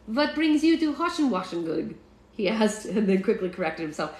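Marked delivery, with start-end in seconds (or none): 0.1-1.9 high mock German boy; curious child with a put-on German accent; cute German accent. A narrator's reading. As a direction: ask it as a little German kid. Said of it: "in a cute German accent".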